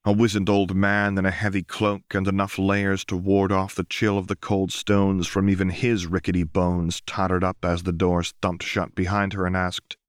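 ordinary delivery, nothing marked

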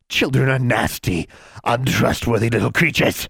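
high, sneering witch voice; menacing hag. Acted. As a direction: spit the insult like a hag. Hag turns hostile.

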